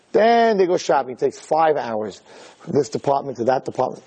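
normal talking voice

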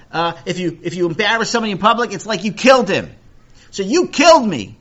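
loud, high-pitched impassioned lecturing; aggrieved kid declaiming a lesson; indignant argument. Acted. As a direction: argue the case at the top of your voice. Acted child arguing from rabbi's lesson.

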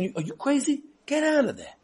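high scornful jeer; light mock jeer; mocking crowd. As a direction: laugh him off the stage.

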